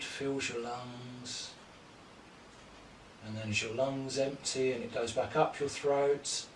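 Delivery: calm, measured yoga-teacher cues timed to the breath; guided yoga instruction. Acted. Teacher guides home class through poses, calm paced cues. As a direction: talk the class through each pose breath by breath.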